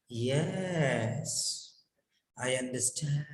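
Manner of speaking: low, soft "Yes, I understand" with a falling tone. Shown as a demonstration. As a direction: let "understand" drop quietly at the end. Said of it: "Falling tone".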